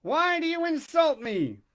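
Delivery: put-on old man's raging challenge; in a fury. A narrator's reading. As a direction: Geppetto rounds on the carpenter in rage. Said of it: "He said in a fury".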